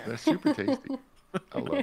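excited. Real, not acted. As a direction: gush about the food. Raving about food.

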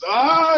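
high, loud scream acted for a joke; hammed-up bedroom scream. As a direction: let out a mock ecstatic scream. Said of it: "and she's screaming".

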